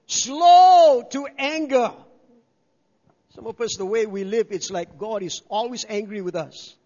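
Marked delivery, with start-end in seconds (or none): 0.1-1.9 slow emphatic. Acted. Preacher dwells on the phrase.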